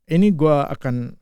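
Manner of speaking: ordinary talk, nothing marked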